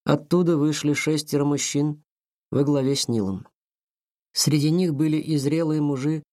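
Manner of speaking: ordinary delivery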